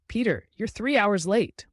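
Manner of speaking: loud angry boss; shouting boss. A narrator's reading. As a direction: shout it at him. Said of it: "The boss shouted".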